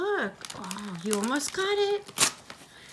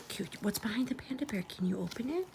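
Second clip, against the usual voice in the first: whispering; 10 dB quieter, much faster than usual. Real, not acted. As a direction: hush it to a whisper. Whispering to toddler over book.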